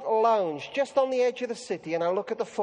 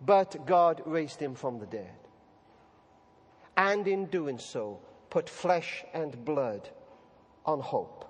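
hushed proclamation; 8 semitones lower, 7 dB quieter, slower than usual. Acted. Resurrection line after long pause.

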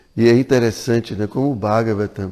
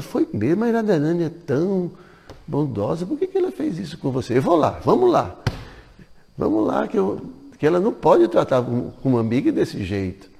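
put-on higher, fussing older woman taking sides; indignant mother-in-law; 7 semitones higher than usual. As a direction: sound outraged on the friend's behalf. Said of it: "Ela falou". (sogra indignada, voz mais aguda)

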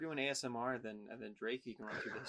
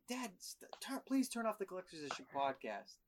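put-on pleading kid, pitched up; whiny kid; 6 semitones higher than usual. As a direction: beg like a bored kid in the backseat. Playing kid begging dad.

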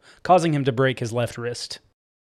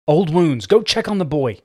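raised, insistent order; demanding; 4 semitones higher, 3 dB louder than usual. A narrator's reading. Injured woman sending Eric to the hurt boy, demanding. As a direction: demand it, pitch climbing on the command. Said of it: "she demanded".

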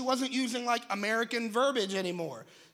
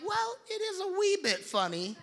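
high, cheeky little British voice; a child copying a cartoon pig's British lilt; lightly done British accent; British kid accent; 8 semitones higher than usual. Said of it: "she'd be like".

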